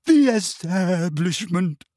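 slow, loud, wavering and grand; dramatic wavering. A narrator's reading. Trelawney answering Parvati, dramatic and wavering. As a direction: declaim it like a martyr. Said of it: "in a deep, dramatic, wavering voice".

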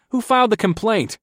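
high-pitched startled question; shocked. A narrator's reading. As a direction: demand it, taken aback. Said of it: "I blurted out in shock".

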